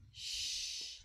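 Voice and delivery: "Sh" as a sustained rush of air; long sh sound. Shown as a demonstration. Teacher naming the digraph, long "Sh". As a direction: hold "Sh" without voice. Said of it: "I hear the air come long".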